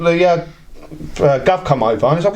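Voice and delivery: ordinary talk, nothing marked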